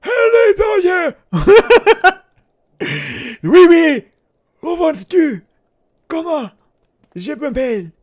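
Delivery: very high, wheezy mock French; out-of-breath French person. Silly French villain greeting someone, breathless high French accent. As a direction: squeak French words as if out of breath. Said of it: "Just an out-of-breath French person".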